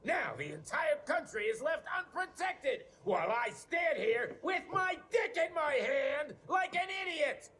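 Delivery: high, shouting tirade at the team; furious rant. Acted. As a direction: tear into them, yelling. Furious boss chews out staff.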